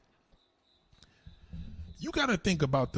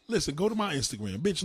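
ordinary talk

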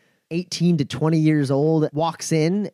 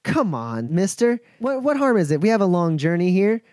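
higher, pleading with a pout; pouting young woman; 7 semitones higher than usual. Acted. Woman pleading with the lawman, pouty and higher. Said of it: "kinda pouts almost just slightly at Clayton and goes".

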